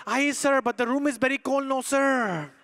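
imitated Filipino accent, politely protesting; mock Filipino excuse; Filipino lady. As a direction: explain sweetly as a Filipino server, stretching the sir. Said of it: "She goes".

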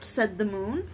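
plain reading voice

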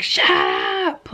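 high, sharp mock yell of the neighbor; imitating angry shout. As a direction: bark it like the angry man next door. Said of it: "sometimes I'll hear him be like".

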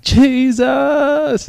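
high, loud, long-held cry; re-enacted scream, stretched out high; retold scream. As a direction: cry out the name to the heavens. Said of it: "I'm screaming".

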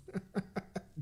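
soft snickering laugh; quiet chuckle. Real, not acted. Prankster snickering to himself between calls, soft chuckle. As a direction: chuckle softly to yourself.

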